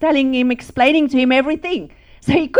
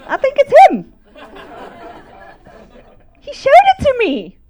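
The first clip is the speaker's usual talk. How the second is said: high, louder, laughing through the words; 12 semitones higher, 5 dB louder than usual. Retold or imitated. Wife telling her husband God showed her, laughing. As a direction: laugh as you tell him.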